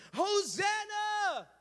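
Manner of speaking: a long, slow, high acclaiming cry; crowd shouting Hosanna. Acted. Crowd welcoming Jesus, shouting Hosanna. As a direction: call it out like a whole crowd. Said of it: "everybody is shouting".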